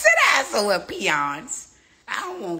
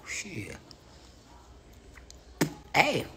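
usual speaking voice

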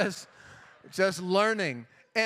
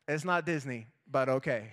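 lower, deadpan reluctant agreement; sulky acceptance, lightly voiced; mock unimpressed children; grudging kids; 7 semitones lower than usual. Kids' grudging reply.

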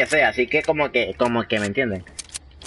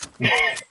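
high squeal of laughter; squeaky laugh. Real, not acted. Reacts to chat.

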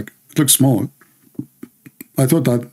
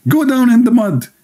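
drill sergeant scream; 13 semitones higher than usual. Retold or imitated. Drill sergeant screaming at a recruit to get down. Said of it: "he screamed at me".

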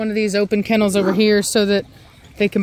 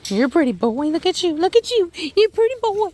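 high sing-song praise, rising higher; puppy praise voice; 8 semitones higher than usual. Real, not acted. Owner gushing at her puppy, high and sing-song. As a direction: gush over the pretty puppy.

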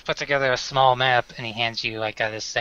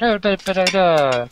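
fake foreign language; 4 semitones higher than usual. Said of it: "That's terrible. Like, fake language".

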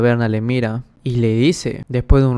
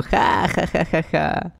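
higher, softer mocking laughter; ugly laugh; 5 semitones higher, 5 dB quieter, much slower than usual. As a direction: laugh nastily at the mess. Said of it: "se comienzan a reír bien feo".

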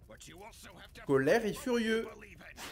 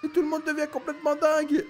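alarmed outcry; 9 semitones higher than usual. Real, not acted. Player dismayed as the characters start shouting, high alarmed whine. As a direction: protest as everyone goes crazy. Memory touched again, chaos.

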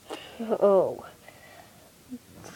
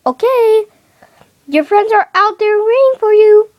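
higher, louder mother voice; mom voice; 9 semitones higher, 5 dB louder than usual. Acted. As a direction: play the cheerful mom. Kid voicing the mom.

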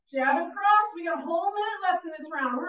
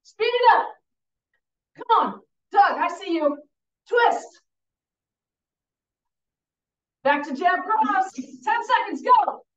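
loud urging, pitched up high; coach urging on; 6 semitones higher, 4 dB louder than usual. Real, not acted. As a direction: push them hard to the finish. Coach pushing class to speed.

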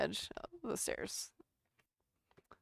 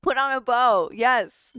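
loud, bright order to the dog; playful loud command; 3 semitones higher, 10 dB louder, slower than usual. Real, not acted. Ordering the dog to do tricks, playful loud command. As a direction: tell the dog to take a bow, loudly.

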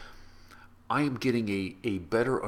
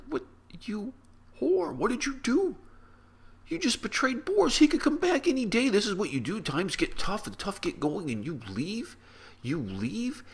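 much higher, louder accusing outburst; accusing family; 3 dB louder than usual. Acted. Family turns on Veronica, much higher and louder, accusing. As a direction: hurl it at her like a betrayed family.

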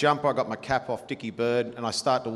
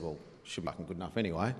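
muttered aside; 7 semitones lower, 11 dB quieter, faster than usual. Retold or imitated. Muttered reply to the captain.

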